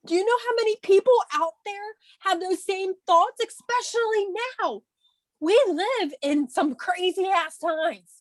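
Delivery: high-pitched, emotional plea; overcome, voice climbing high; getting emotional. Real, not acted. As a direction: plead with real feeling, voice pushed high. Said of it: "So sorry, I'm getting emotional".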